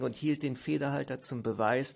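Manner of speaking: usual speaking voice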